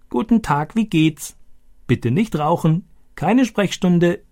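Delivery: high, croaky parrot imitation; boy imitating the talking parrot, high and croaky; croaking parrot. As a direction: squawk each phrase like the parrot Boga. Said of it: "Stani krächzte diese Sätze wie Boga".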